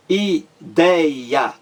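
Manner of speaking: ideia split as i-DEI-a; syllable by syllable. Shown as a demonstration. Teacher counting three syllables, ideia said beat by beat. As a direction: break ideia into three beats, weight on dei. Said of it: "três sílabas".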